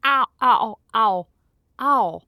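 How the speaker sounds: high, repeated "ow" in pain; pained yelps. Acted. Host chips her tooth on a mug, pained yelps. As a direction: yelp sharply as the tooth chips.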